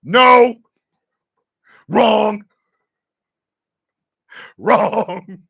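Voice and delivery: flat loud "Wrong."; loud correction. Real, not acted. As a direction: shut it down hard.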